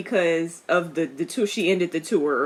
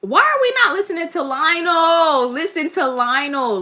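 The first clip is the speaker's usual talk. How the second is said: higher and louder, exasperated; exasperated plea; 9 semitones higher, 4 dB louder than usual. Real, not acted. Viewer urging the king to heed Lionel, exasperated plea. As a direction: beg them to just listen. Pleading with the show's characters.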